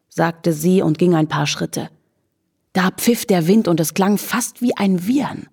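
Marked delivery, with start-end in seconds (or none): none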